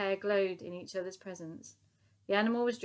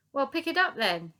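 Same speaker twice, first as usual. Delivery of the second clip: higher, louder command; ordering; 6 semitones higher, 6 dB louder than usual. A narrator's reading. Sergeant major to Dotty, ordering her to pick it up. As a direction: give the order sharply. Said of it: "he ordered".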